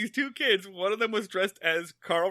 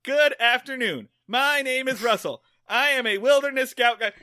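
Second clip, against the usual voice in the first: loud, higher imitation of a scout reading aloud; Russell reciting; 8 semitones higher, 7 dB louder than usual. Kid reads out the wilderness guide, loud recital. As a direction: recite it verbatim, pushed high and loud. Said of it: "reads verbatim from the guide".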